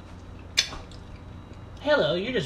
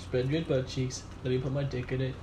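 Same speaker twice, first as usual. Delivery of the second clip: ordinary delivery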